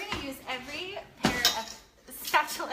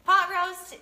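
announcing; 6 semitones higher, 12 dB louder, slower than usual. Real, not acted. Announcing the dish to camera.

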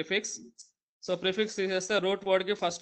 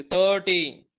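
Thirty with థర్ said loud and long; stress on THIR; 6 dB louder, much slower than usual. Shown as a demonstration. Teacher showing students the stressed first syllable. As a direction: punch and stretch the థర్ of thirty. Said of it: "దీర్ఘం వస్తుంది".

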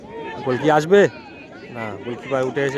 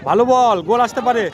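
rising, louder and higher with each call; excited build-up; 8 semitones higher, 6 dB louder than usual. Real, not acted. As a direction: sense the goal coming and push up. Commentator builds toward goal.

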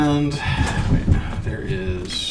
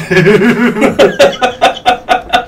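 big real laugh, high and loud; a loud laughing fit; loud burst of laughter; 12 semitones higher, 14 dB louder than usual. Real, not acted. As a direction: crack up loudly. Laughing at friend's joke.

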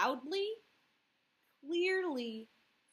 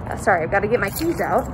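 through a mask; 5 semitones lower, 16 dB louder, faster than usual. Real, not acted. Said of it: "can't understand me with the mask on".

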